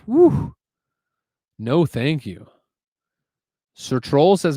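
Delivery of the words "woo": loud, high-pitched "woo"; high whoop. Real, not acted. Streamer reacting to hot weather, high whoop. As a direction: let out a whoop.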